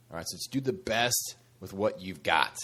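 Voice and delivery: ordinary talk, nothing marked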